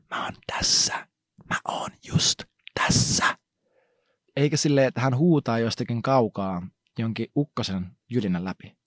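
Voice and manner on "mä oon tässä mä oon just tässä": soft close whisper, slow. Retold or imitated. God whispers to Elijah that he is near. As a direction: whisper it right by his ear. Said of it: "hän kuiskasi".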